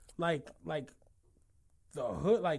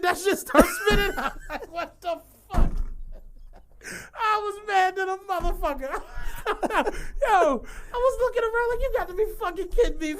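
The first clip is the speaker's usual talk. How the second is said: high, cracking up at the punchline; laughing; 12 semitones higher, 4 dB louder than usual. Real, not acted. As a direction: tell it through helpless laughter. Laughing at own story's punchline.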